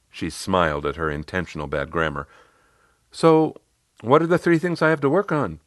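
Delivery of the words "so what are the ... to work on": higher, louder client voice; Riley. Retold or imitated. Client asking coach what to work on, higher voice. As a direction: pitch it up as the client moving on. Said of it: "she said".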